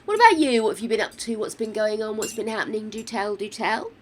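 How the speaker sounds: normal talking voice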